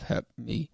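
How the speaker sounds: soft "Help me" with the l swallowed; garbled speech. Retold or imitated. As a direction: say "Help me" softly, barely forming the words. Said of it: "he can't really, like, formulate real words".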